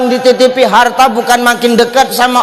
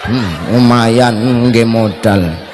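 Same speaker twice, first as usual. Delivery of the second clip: sly mutter; 9 semitones lower, much slower than usual. Acted. Husband pleased pulling off rings.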